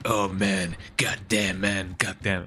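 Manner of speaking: mock gruff swearing, low and slow; a lightly voiced tough-guy drawl of goddamn; imitating Leon. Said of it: "So Leon is like".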